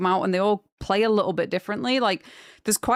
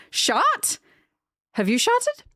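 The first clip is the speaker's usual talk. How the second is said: high puzzled rise; baffled; 12 semitones higher, slower than usual. Real, not acted. Streamer baffled by a chatter's word, high and rising. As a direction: question it in disbelief.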